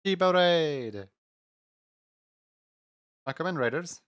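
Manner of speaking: high, slow cutesy streamer voice; cute anime streamer. Acted. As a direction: greet them in a high cute voice. Streamer greeting raiders in cutesy voice.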